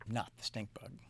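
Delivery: soft hushed twist; whispered reveal. A narrator's reading. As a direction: breathe it like a secret. Reveal of the vote.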